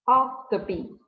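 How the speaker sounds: Octopi said slowly and clearly; model pronunciation. Shown as a demonstration. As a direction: model Octopi for students to repeat. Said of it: "en plural es octopi". (pronunciación modelo de Octopi)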